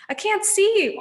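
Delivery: high, loud panicked yell; three hags yelling. A narrator's reading. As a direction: shriek in blind panic. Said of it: "all three sisters yelled".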